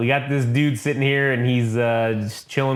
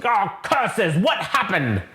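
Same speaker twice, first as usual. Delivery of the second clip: higher, fussy exclamation; mock dismay, pitched up; 6 semitones higher, slower than usual. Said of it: "Thanos comes in and he goes".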